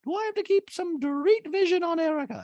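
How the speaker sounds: extremely high caricature; Dorit impression. Dorit wondering about watching Erica, squeaky high voice. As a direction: squeak through it in mock musing. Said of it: "Dorito the Talking Head goes".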